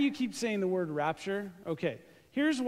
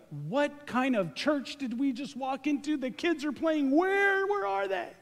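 mock bewildered visitor; 7 semitones higher than usual. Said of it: "You're like".

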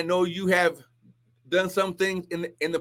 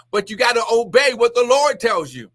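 ordinary talk, nothing marked

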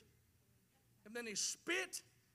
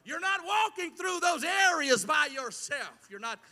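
rising preacher shout, higher and louder; 6 semitones higher, 13 dB louder than usual. Real, not acted. Preacher to congregation, a loud reassuring shout. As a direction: call it out to lift the hurting.

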